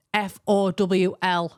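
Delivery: F O W L spelt slowly, letter by letter; deliberate, slow spelling of F O W L. Real, not acted. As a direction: pause slightly on each of F O W L.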